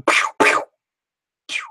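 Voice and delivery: whispered pew pew shots; mouth gun noise. Acted. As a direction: make toy laser gun sounds. Gun sound effect.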